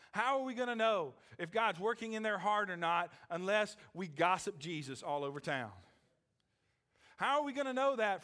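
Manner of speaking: loud, high-pitched, urgent sermon delivery; impassioned pulpit questioning; forceful preaching. Real, not acted. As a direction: preach it hard, building to a high cry.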